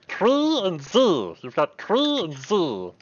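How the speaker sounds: slow, high pixie naming itself; drawn-out pixie name. Acted.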